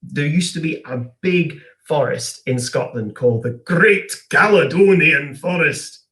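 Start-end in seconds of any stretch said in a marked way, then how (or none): 3.6-6.0 slow, grand Scottish accent. Shown as a demonstration. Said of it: "You have to say in a Scottish accent".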